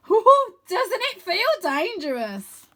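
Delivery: very high, loud, slow thrilled question; boy thrilled on flying dragon; gleeful thrill. Acted. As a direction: savour the danger. Wynne thrilled.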